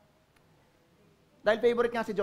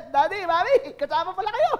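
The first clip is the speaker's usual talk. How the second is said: much higher, louder, laughing tease; cheeky Joseph; 8 semitones higher, 5 dB louder than usual. Acted. Joseph telling parents they bow too, laughing.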